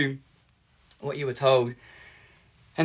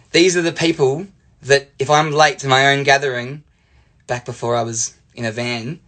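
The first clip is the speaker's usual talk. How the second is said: usual speaking voice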